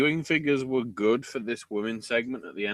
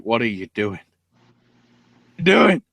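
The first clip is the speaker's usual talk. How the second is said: bewildered father; 10 dB louder, slower than usual. Retold or imitated. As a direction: play the helpless old man at ringside. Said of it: "looking on from the ring like".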